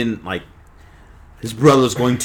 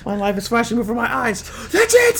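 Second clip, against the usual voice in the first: high mock despair; 9 semitones higher than usual.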